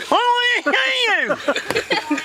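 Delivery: very high, slow, garbled wail; upset Vicky. Acted. Vicky demands to see the boss, slow high garbled upset. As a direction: draw out the demand in a mangled voice.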